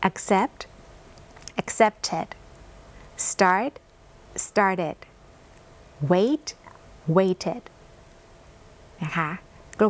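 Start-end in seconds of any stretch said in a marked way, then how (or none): 0.1-7.6 accept, accepted, start, started, wait, waited read as model pairs; model word list. Shown as a demonstration. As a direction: read each pair clearly for students. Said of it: "ลองอีกครั้งนะคะ". (อ่านคู่คำกริยาช้าๆ ชัดๆ เป็นตัวอย่าง)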